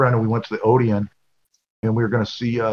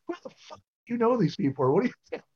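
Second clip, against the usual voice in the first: friends' mock disbelief; 9 semitones higher, 4 dB quieter than usual. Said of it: "get on me and go".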